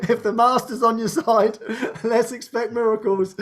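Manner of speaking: words breaking into real laughter; laughing encouragement. Real, not acted. Encouraging a friend to expect miracles, laughing. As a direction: cheer them on through a laugh.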